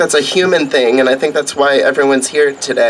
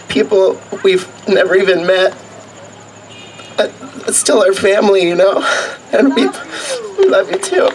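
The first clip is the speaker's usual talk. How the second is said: choked up with tears, higher; after crying; 5 semitones higher than usual. Real, not acted. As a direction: voice breaking, pitched up.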